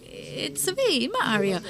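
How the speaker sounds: high, singsong Italian video-game catchphrase; Mario. Retold or imitated. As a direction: imitate a game hero's Italian lilt, starting high. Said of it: "they always said ... that little song".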